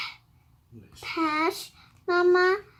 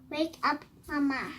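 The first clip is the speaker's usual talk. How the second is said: ordinary delivery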